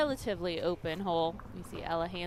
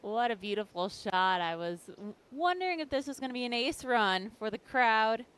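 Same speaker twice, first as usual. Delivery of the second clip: usual speaking voice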